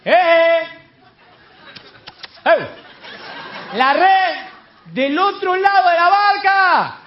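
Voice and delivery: high, loud repeated hollering; long drawn-out shout to a distant boat; shouting from the shore. Retold or imitated. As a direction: call insistently across the water. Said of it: "cada uno que grita a la orilla".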